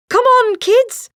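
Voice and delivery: high, loud, slow call to the pups; rally the puppies playfully; hearty call. A narrator's reading. Pongo urging puppies.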